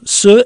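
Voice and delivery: "Su" punched high and loud; stress on Su. Shown as a demonstration. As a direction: hit "Su" hard, teacher showing emphasis. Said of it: "is emphatic".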